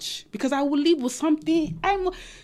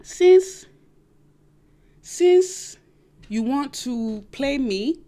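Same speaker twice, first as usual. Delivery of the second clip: knowing scheming woman; 4 dB louder, much slower than usual. Retold or imitated. Woman sizing up cheating man, slow and knowing. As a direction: plot it slowly and coolly. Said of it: "you say".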